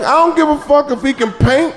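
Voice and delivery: high, loud exasperated retort; incredulous comeback to a friend; mock outrage. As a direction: snap back in disbelief. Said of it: "I'm like".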